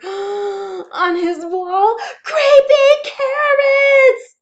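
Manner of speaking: gasp, then slow high loud horrified cry; terrified cry. A narrator's reading. Narrator acting fright at the carrots on the wall. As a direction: drag it out in pure terror. Scare moment in story.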